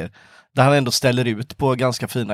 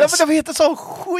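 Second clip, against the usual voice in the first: high mock-outraged cry; exasperated outburst; 13 semitones higher than usual. Real, not acted. Friend rants at ancient Greek names, exasperated high outburst. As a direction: throw up your hands at the silly name, pitched way up.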